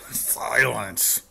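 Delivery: very loud, imperious bark; a king's booming order; barked command. Acted. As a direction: bark it to cut him off. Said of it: "the king of the turtles barked back".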